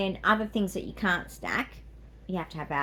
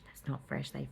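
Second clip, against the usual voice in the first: whispering; 6 semitones lower, 10 dB quieter than usual. Real, not acted. Whispered aside about Subway.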